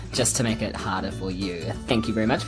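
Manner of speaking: ordinary talk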